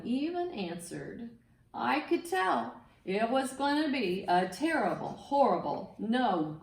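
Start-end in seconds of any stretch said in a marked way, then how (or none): none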